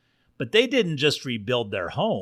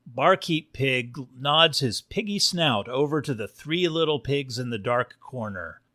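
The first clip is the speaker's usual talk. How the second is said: ordinary delivery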